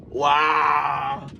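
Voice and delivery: tag. Real, not acted. Angry rant about the mother.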